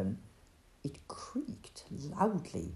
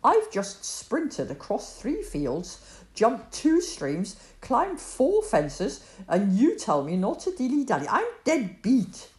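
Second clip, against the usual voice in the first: furious out-of-breath rant; 5 semitones higher, 7 dB louder than usual. Acted. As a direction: rant each feat indignantly. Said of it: "shouted Joram furiously".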